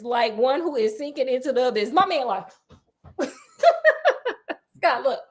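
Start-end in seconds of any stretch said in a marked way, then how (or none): none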